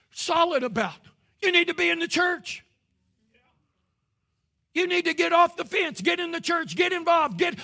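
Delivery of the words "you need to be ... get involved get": higher and louder, firm commands; strict preaching. Retold or imitated. Preacher tells the crowd to join a church, strict and loud. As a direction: lay down the law. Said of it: "he talked just real strict".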